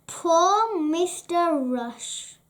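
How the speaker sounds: ordinary delivery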